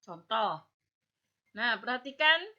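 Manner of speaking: ordinary talk, nothing marked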